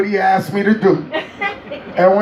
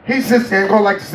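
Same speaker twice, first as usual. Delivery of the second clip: ordinary talk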